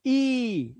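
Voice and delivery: ई held out long; long vowel ee. Shown as a demonstration. Teacher answering own question, long held ee. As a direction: draw out the single vowel ई. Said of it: "किससे पढ़ना है".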